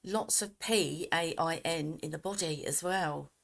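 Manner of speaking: ordinary delivery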